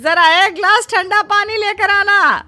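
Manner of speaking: calling out. Acted. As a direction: call out to the daughter-in-law in the next room. Mother-in-law calls to daughter-in-law.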